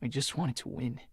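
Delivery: soft confession. Acted. Guilty confession continues.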